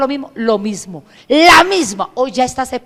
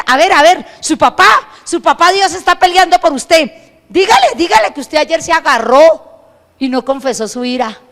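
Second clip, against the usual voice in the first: louder, higher, urgent sermon; fiery preaching; 4 semitones higher, 8 dB louder than usual. Acted. Preacher urging the congregation to confess, loud and higher. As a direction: drive it home to the congregation.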